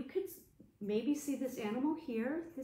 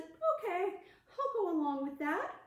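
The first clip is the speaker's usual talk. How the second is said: lightly voiced hare; 8 semitones higher than usual. Hare's voice.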